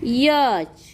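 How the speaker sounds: normal talking voice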